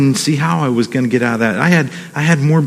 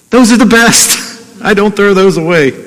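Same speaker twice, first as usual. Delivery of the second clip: tender; 6 semitones higher than usual. Real, not acted. Pastor tells church about son's card, tender.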